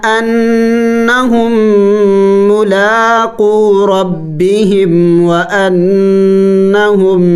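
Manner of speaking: slow, drawn-out chanted Quranic recitation. Shown as a demonstration. Teacher recites the verse to the student in slow chanted recitation. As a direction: intone each word long and melodic.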